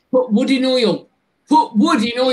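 mock the Barnsley way of saying it; light Yorkshire Barnsley accent impression; imitating Barnsley accent. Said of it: "do you know what they say?".

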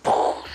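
loud puffed poof for a gunshot; gunshot noise. Retold or imitated. Gunman mimics his own shot with a loud poof. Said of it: "And I went".

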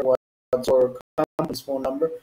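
normal talking voice

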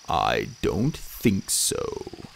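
very slow, low god voice; cold dismissal. A narrator's reading. Horned God rebuffs Bastet.

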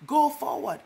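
loud, high preacher's call; loud, rising commands from the pulpit; rousing exhortation. Real, not acted. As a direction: drive the congregation forward.

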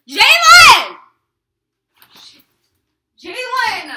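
loud high yell of a name across the yard; calling out. Real, not acted. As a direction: shout to someone far away. Calling out to Jalen outside.

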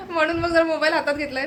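laughing at herself. Real, not acted. Telling viewers she picked up her phone, laughing.

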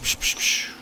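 unvoiced hushing hiss; soft psch sounds under the breath. Real, not acted. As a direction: shush them fast, no voice at all. Hushing while hiding from enemy.

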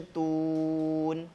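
Wazaitun chanted slowly, stopping on the final n; showing the pause form of the word, recited; pausal recitation. Shown as a demonstration. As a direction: recite Wazaitun as a verse ending, drawn out. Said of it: "kita masuknya kepada mad arid lis sukun".

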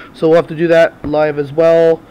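ordinary talk

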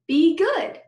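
high, loud, daring anyone to argue. A narrator's reading. Girl answering the teacher, daring anyone to disagree. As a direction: say it like a challenge. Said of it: "like she was daring anyone to disagree".

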